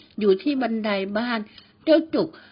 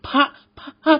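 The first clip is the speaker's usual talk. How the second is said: higher and louder, stammering in terror; a scared boy choking out the word ghost; shaky, panicked stutter; trembling stammer; 4 semitones higher, 7 dB louder than usual. A narrator's reading. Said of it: "พูดเสียงสั่นๆ".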